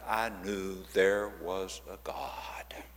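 slow, soft whisper of wonder; awed whisper. Retold or imitated. Teacher telling of the moment she found faith, slow whisper. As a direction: hush it as if realizing a miracle.

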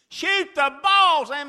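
high, full-throated shout, drawn out; yelling to son. Retold or imitated. Dad yelling from the stands at his son, loud shout. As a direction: scream it from the bleachers. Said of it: "I yell".

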